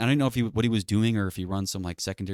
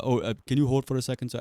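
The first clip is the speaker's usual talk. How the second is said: put-on higher, polite Indian-accented request; 5 semitones higher than usual. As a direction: imitate an Indian support agent's accent. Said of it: "racist Indian accent".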